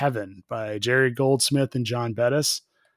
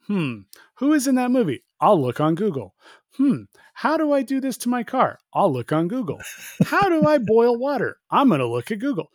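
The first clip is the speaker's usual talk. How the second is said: high, exaggerated wondering voice; hammed-up puzzled self-questioning; mock pondering; 9 semitones higher than usual. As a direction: wonder aloud in a high mock-curious sing-song. Acting out himself googling everything.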